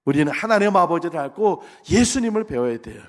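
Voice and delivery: normal talking voice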